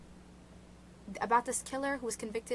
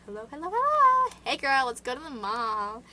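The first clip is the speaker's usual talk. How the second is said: high, bubbly phone greeting; mock phone call; 3 semitones higher, 8 dB louder than usual. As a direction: answer like a chirpy teen girl. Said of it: "I'll just be like".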